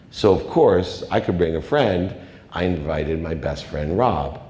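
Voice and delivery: ordinary delivery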